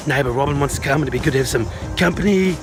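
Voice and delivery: ordinary talk, nothing marked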